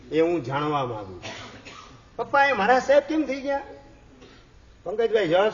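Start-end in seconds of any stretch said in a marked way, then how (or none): 2.2-3.6 put-on high, quick childlike question with a laugh in it; curious little son, laughing. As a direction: play the boy pestering his father, amused. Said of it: "તમારો દીકરો પૂછે". (હસતાં હસતાં નાના દીકરાનો ઊંચો સવાલ)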